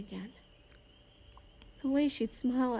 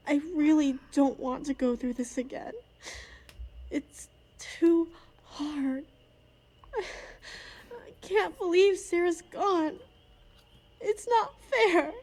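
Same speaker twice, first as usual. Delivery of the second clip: louder and slower, voice breaking into tears; crying; 5 semitones higher, 4 dB louder than usual. Acted. Mourner breaking down over a lost friend, crying. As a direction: grief spilling over, sobbing out the words.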